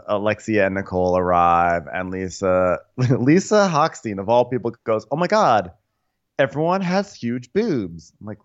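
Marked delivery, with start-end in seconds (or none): 5.1-8.1 mock gushing. Quoting Lisa.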